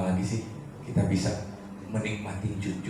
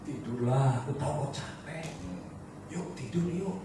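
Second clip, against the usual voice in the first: soft, higher lullaby-like coaxing; tender soothing; 5 semitones higher, 5 dB quieter than usual. Retold or imitated. Grandfather coaxing his crying grandchild to sleep, tenderly. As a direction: gently hush a tired child to sleep.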